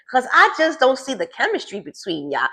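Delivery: usual speaking voice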